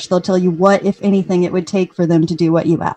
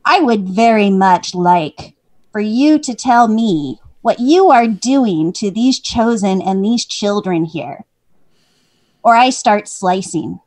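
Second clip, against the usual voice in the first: slow, deliberate interrogation; measured threat; 4 dB louder, slower than usual. Acted. Smudge questioning the priest at knifepoint, slow and menacing. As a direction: spell out the threat slowly, word by word.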